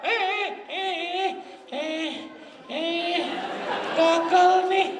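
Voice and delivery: put-on high, drawn-out child's whine; mock child's fussy whine; whining child. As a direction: whimper like a kid denied his game. Mimicking a whining child who lost.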